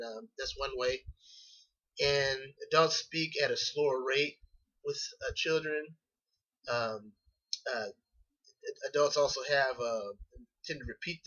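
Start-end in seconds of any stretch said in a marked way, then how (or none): none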